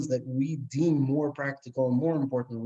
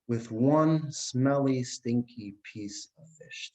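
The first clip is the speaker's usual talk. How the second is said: slow savouring; much slower than usual. Acted. Husband savours the smelly fish.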